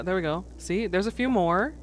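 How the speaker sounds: usual speaking voice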